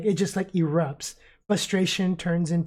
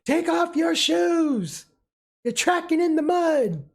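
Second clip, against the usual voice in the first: high, slow yell from across the room; mother yelling at the boys about muddy shoes, shrill shout; yelling at kids; 11 semitones higher, 3 dB louder, slower than usual. Acted. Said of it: "she yells at them".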